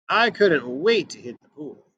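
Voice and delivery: usual speaking voice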